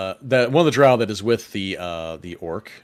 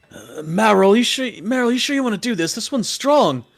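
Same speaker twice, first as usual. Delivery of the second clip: much higher, unsure drow voice; worried drow; 13 semitones higher, 6 dB louder than usual. Acted. Beaten drow to orc about Kitty, worried warning. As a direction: warn your friend off nervously.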